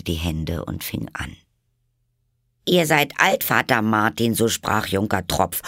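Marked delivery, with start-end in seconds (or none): 2.7-5.6 put-on much higher, louder girl reciting; poem recital. A narrator's reading. Alice recites the poem to the caterpillar. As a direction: recite it with hands folded.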